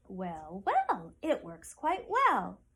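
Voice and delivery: put-on old woman drawling happily; pleased old woman. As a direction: savour each well like a satisfied granny. Old woman pleased while sewing.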